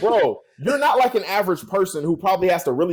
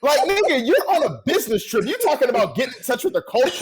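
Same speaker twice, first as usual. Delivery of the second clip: high-pitched, incredulous comeback; exasperated retort; 5 semitones higher than usual. Retold or imitated. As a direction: argue back at the soundbite in disbelief. Acted exasperated retort to soundbite.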